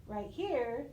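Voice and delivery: ordinary talk, nothing marked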